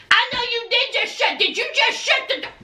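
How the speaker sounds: high, hammed-up screaming rant; acted furious yelling. Woman shut out by her man, acted furious yelling. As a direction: explode at him so the neighbours hear, play-acted. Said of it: "they would have heard me".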